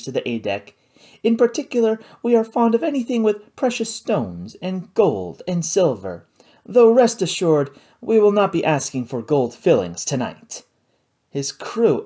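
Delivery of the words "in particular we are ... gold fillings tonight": a lightly voiced, genteel pirate chief, a little higher; charming host doing a robbery; mildly voiced smooth pirate captain; suave pirate captain. A narrator's reading. Pirate captain Spearglass speaking.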